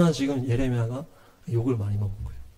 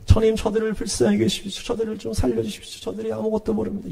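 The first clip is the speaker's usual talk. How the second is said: higher, softer acted intercession; pleading prayer; 4 semitones higher, 3 dB quieter than usual. Prophet begging the Lord to spare the people, pleading. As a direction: plead gently on their behalf in a raised, even voice. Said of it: "예레미야는 막는 기도를 했거든요".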